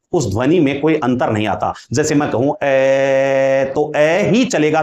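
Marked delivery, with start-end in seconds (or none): 2.6-3.7 ए held very long on one steady note; drawn-out vowel. Shown as a demonstration. Teacher proving a vowel stays the same however long, drawn-out ए. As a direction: sustain the vowel ए without changing its sound. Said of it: "चाहे मैं कितनी देर तक इसका उच्चारण करूं".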